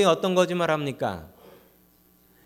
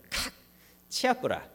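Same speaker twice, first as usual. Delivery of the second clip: a curt, high snap in Gyeongsang dialect; gruff Gyeongsang husband; 7 semitones higher, 6 dB quieter than usual. Retold or imitated. 아내에게 퉁명스러운 경상도 남편의 사투리. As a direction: brush her off like a blunt Gyeongsang husband. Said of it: "경상도 남편은 이렇게 얘기합니다".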